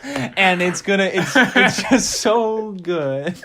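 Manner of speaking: loud, high, gleeful gushing through laughter; laughing excitedly. Real, not acted. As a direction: crack up while you rave, stretching the words. Pitcher cracks up at own joke.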